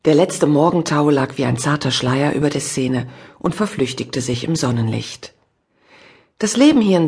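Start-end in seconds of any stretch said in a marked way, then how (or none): none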